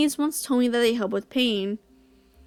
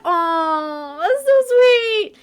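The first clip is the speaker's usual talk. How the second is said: high, slow, tearful gush; 5 semitones higher, 3 dB louder, much slower than usual. Real, not acted. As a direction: overcome by how sweet it is. Gushing at Waltz caring.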